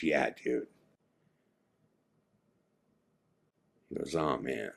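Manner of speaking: low, subdued, soft reply; retold drained murmur; shaken confirmation. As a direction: confirm it quietly, still haunted. Shaken friend confirms nightmare.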